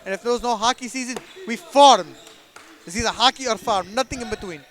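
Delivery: lightly put-on Russian accent; a hint of a Russian cousin. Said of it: "a little message from my cousin Boris".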